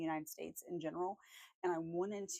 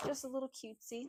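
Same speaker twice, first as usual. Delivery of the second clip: higher, sweet cutesy voice; 7 semitones higher than usual. Shown as a demonstration. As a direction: say it cute and playful. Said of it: "repeated higher".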